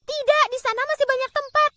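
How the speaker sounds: Alice arguing with the tea party; Alice protesting. A narrator's reading. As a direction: insist brightly as a young girl. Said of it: "kata Alice".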